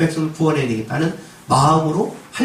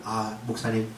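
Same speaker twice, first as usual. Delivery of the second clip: humble acceptance; 6 semitones lower than usual. Retold or imitated. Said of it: "이래야 되는 거예요".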